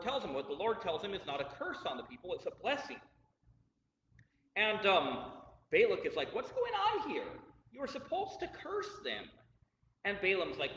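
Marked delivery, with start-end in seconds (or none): 6.3-9.3 high-pitched, baffled protest; indignant complaint. Retold or imitated. King protesting to the prophet he hired to curse, mock indignant.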